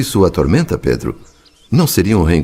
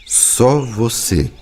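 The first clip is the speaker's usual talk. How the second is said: só você drawn out very slowly, only lightly stressed; slow emphatic; 5 semitones higher, much slower than usual. Acted. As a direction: linger on "só" to set him apart.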